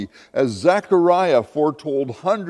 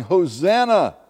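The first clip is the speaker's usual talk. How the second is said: mild, higher-pitched mock cheer; raised cry of acclaim; crowd shout; 5 semitones higher, 4 dB louder than usual. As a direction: imitate a shouting crowd, somewhat restrained. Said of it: "crowds were shouting".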